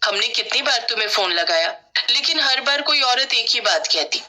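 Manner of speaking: plain reading voice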